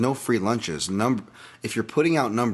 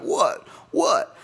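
Nate Diaz taunt; 12 semitones higher than usual. Retold or imitated. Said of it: "Nate Diaz would be like".

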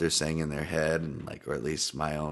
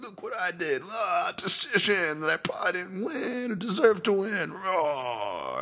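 much higher, put-on smug fighter voice; mocking fighter voice; 11 semitones higher, slower than usual. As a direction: imitate a fighter gloating after a fight.

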